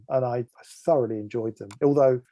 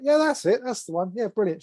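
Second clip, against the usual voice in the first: higher-pitched, imitating an approving bandmate; retold delighted approval; 8 semitones higher than usual. Bandmate approving the worked-out keyboard part, retold with delight. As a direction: act out his pleased "got it" reaction.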